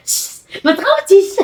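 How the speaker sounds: high, slow sing-song shushing; sing-song hush. Real, not acted. Mother to excited daughter, a high, slow sing-song hush.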